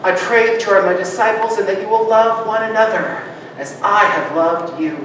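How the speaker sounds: ordinary talk, nothing marked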